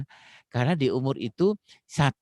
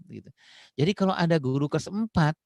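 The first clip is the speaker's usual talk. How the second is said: ordinary talk, nothing marked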